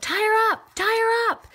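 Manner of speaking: loud, high-pitched sideline holler; imitated yell. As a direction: yell it out like a hockey dad. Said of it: "this father yells out".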